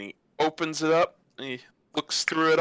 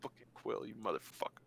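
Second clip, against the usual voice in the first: much softer, grumbled swearing; muttered curse; 8 semitones lower, 15 dB quieter than usual. Acted. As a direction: curse it under your breath. GM curses the quill under breath.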